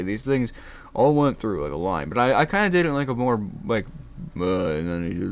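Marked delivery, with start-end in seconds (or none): none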